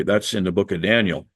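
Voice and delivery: usual speaking voice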